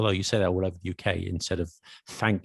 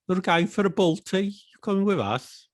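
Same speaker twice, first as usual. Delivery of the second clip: high, bright Cockney voice; Cockney accent; 9 semitones higher than usual. Shown as a demonstration. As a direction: put on a London Cockney accent. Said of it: "better than my Cockney accent".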